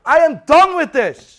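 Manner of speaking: very loud, high outburst; furious, exasperated shout; fed-up yell. Real, not acted. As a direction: shout it like you have had enough. Fed up, ranting at bank.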